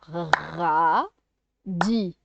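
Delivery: "radis" with a long rolled R, then a separate "dis"; two syllables. Shown as a demonstration. Teacher showing two syllables, "radis" slowly split. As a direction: draw out "ra", pause, then "dis". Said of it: "Il y a deux syllabes".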